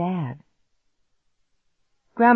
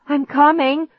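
high, louder answering call; dazed call; 10 semitones higher, 6 dB louder than usual. A narrator's reading. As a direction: call back through dizziness. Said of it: "I said through a dizzy haze".

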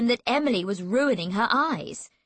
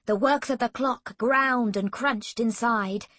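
ordinary delivery

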